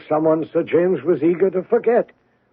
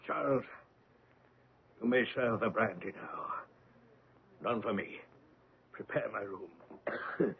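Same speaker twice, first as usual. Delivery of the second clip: weary and hushed; 6 semitones lower, 11 dB quieter than usual. Acted. Old man retiring, haunted by past.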